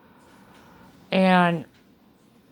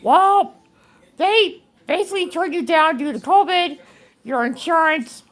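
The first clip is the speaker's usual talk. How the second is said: voicing care team; 6 semitones higher than usual. A speaker recounting the care team's refusal, lightly acted official tone. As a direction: mimic the care team breaking bad news.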